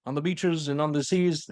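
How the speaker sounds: slow, oratorical wartime speech impression; Winston Churchill. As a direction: intone it like a rallying address. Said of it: "Churchill, Winston Churchill".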